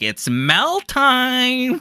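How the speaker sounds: very high, loud, very slow rising call; imitating Destiny. Mocking Destiny hyping up his stream, high and drawn out. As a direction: stretch it out like a gleeful announcer. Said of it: "he was like".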